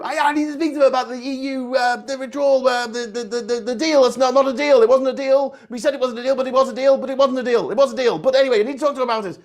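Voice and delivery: mock Boris going in circles about the deal; mad caller Boris. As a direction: babble on like a crank caller. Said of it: "sounded like those mad people who call up".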